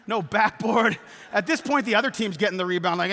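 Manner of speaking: ordinary delivery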